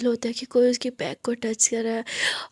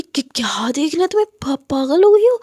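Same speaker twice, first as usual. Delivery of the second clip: higher and much louder outraged cry; shocked scolding; 3 semitones higher, 8 dB louder than usual. Acted. Aadya shouting at Muskan, outraged and loud. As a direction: yell in disbelief. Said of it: "आद्या बोलती".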